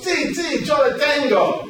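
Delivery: high excited si si; the shortstop happily answers in Spanish; eager agreement. Retold or imitated. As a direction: bounce it back, delighted. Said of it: "And Elio goes".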